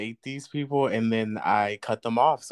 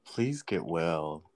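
low, slow, softer get-well wish; mock-solemn echo; 8 semitones lower, 6 dB quieter, slower than usual. Co-host repeating the jab at a flaky date, mock-solemn and low.

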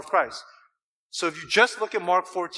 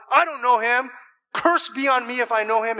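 Peter's denial; 5 semitones higher, 4 dB louder than usual. Acted. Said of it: "Peter said".

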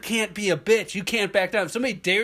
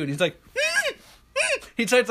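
normal talking voice

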